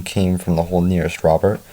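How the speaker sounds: ordinary delivery, nothing marked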